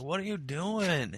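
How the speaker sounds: soft, slow, drawn-out question; slow sleepy mumble, acted; sleepy wife. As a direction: imitate a wife half-asleep, softly. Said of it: "She's sleeping".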